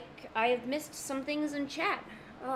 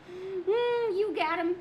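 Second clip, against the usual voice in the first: ordinary talk, nothing marked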